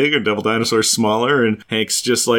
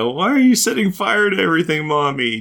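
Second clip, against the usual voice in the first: put-on pleading child; 7 semitones higher than usual. Voicing Victor pleading with mom.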